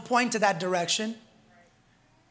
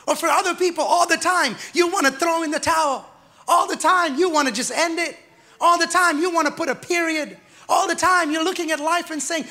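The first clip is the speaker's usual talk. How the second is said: preaching at full force; 3 semitones higher than usual. Real, not acted. Preaching builds.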